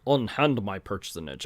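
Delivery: badly faked Russian villain; attempted Russian accent. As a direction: have a poor stab at a Russian accent. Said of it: "I can't do the Russian accent anymore".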